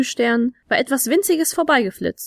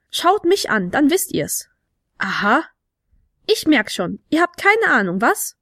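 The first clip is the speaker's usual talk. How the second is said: cocky little kobold; 2 semitones higher than usual. A narrator's reading. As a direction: talk down to them as a tiny kobold.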